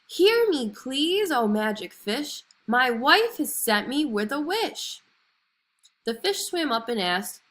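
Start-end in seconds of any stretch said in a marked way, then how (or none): 0.1-5.0 high, loud shout over the storm. A narrator's reading. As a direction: shout into the thunder and waves. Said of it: "The fisherman had to shout".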